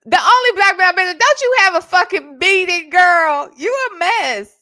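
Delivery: loud, fast teasing call-out; playful yell. Real, not acted. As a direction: holler at her like a friend. Yelling playfully at a commenter.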